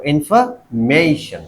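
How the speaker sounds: Information slowed, split before a stressed, drawn-out "may". Shown as a demonstration. Teacher shows where stress falls, information with long stressed "MAY". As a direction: say information as "in-fer-MAY-shun", slowly. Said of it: "तो stress कहां पे लगेगा".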